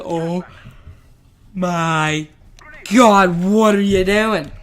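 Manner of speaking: halting start, then a loud burst; retold outraged disbelief, building loud; mock aghast. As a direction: imitate a shocked teacher, pausing between words, then exploding. Teacher aghast at ripped-out cord.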